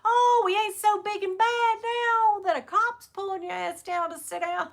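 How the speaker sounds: high, sing-song ribbing of a humbled tough guy; gleeful high mock taunt. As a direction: tease him like he got caught out. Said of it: "I was like".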